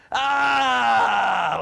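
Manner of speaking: loud high joyful scream, full out; big scream. Retold or imitated. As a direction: let out a big happy scream. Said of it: "Like this big scream".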